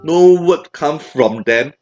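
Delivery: ordinary talk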